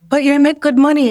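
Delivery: put-on mother's Jamaican accent, high and loud. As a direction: imitate a protesting Jamaican mother. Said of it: "my mother and her Jamaican accent".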